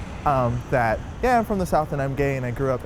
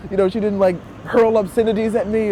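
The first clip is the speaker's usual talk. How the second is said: excited; 10 semitones higher, 7 dB louder than usual. Retold or imitated. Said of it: "I was like".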